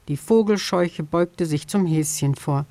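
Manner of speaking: ordinary delivery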